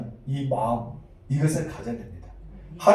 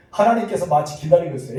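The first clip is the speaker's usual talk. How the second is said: ordinary talk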